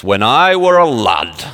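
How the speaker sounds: slow, broad Yorkshire imitation; Yorkshire accent. Shown as a demonstration. Preacher demonstrating northern English speech, slow Yorkshire accent. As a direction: stretch it out in mock Yorkshire. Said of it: "and it's a Yorkshire accent".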